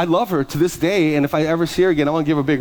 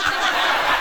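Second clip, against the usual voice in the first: breathy laugh. Real, not acted. Laughing at the eye roll.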